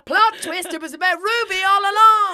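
loud, high, laughing reveal; excited shout. Real, not acted. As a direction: shout the twist gleefully. Joking reveal.

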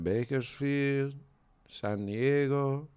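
put-on slow, soft Mexican-accented driver; imitated Mexican accent. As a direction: draw out the place names in a Mexican accent. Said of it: "the quintessential Mexican accent".

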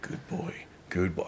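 put-on soft breathy "Good boy, good boy"; hushed doting praise; whispered praise. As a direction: coo it at a dog. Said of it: "him going".

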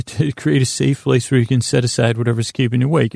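ordinary talk, nothing marked

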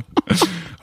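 short high-pitched nervous laugh; high nervous giggle. Real, not acted. Nervous about hearing himself. (petit rire aigu et gêné)

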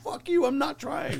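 high-pitched comic protest; mock indignant retort. Comic answering fans' compliments, high mock indignation. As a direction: toss back a put-on indignant retort.

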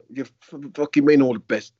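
loud, menacing snarl at the keeper; Gailey turning on the goalkeeper, loud and menacing; menacing outburst. Retold or imitated. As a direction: let it flare up loud and threatening. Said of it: "but he was menacing".

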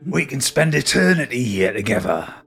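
lightly done gruff London villain; a hint of a menacing Cockney hard man; Billy Butcher voice. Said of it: "he says in his Billy Butcher voice".

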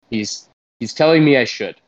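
ordinary talk, nothing marked

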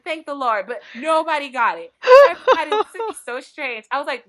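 normal talking voice

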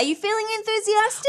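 high, perky question for little ones. Real, not acted. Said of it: "I'm talking in the child voice".

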